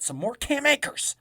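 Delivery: loud exasperated plea; frustrated shout. Real, not acted. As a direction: yell it at the coach.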